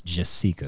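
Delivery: "Jessica" with a long ee for the i; "Jessica" with the middle vowel stressed as ee; accented I. Shown as a demonstration. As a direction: say "Jessica" as jess-EE-ka. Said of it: "an accent on the I".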